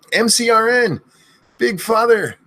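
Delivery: high yelled string of names; screaming names. Acted. Streamer screaming viewers' names in a row, yelling. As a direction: fire off each name as a yell. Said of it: "I end up screaming too much".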